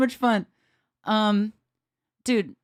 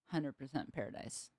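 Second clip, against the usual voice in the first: quiet echo; 7 semitones lower, 15 dB quieter than usual. Real, not acted. Bass player dreaming of Primus opening for Rush, murmured. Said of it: "absolute hundred percent paradise".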